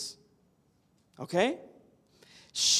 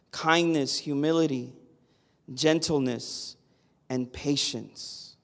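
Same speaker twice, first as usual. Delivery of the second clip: solemn verse read, slowing to the end; calm pulpit reading, softening at the close; scripture reading; 5 semitones lower than usual. Acted. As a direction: read it out like a blessing. Reads Colossians 3:12 aloud.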